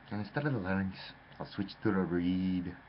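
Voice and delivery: ordinary talk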